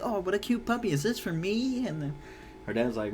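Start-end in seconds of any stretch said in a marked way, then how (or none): 0.0-1.9 put-on high, bright child voice, much higher; a little girl thrilled by a puppy; delighted little girl. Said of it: "She's like".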